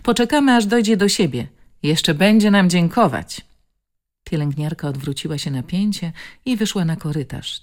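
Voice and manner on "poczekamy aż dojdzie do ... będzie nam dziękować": confident nurse. Acted.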